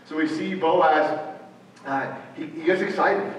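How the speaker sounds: ordinary talk, nothing marked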